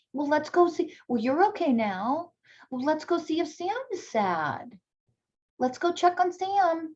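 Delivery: put-on high, bright coaxing voice to a toddler; sing-song parent voice. Shown as a demonstration. Parent leading toddler to friend.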